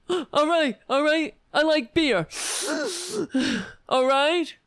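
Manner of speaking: gasp, then yell in outrage; Kavanaugh impression. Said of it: "Kavanaugh is like that".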